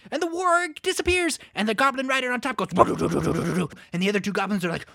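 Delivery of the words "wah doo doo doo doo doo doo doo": low burbling tumbling babble; imitated tumble noise. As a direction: babble a rider's rolling fall.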